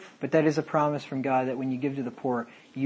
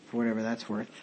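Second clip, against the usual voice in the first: ordinary talk, nothing marked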